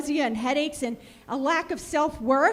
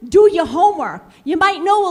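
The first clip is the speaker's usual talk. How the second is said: much louder, punched command; louder rebuke; 2 semitones higher, 10 dB louder than usual. Real, not acted. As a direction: shout it home the second time. Repeats rebuke to board.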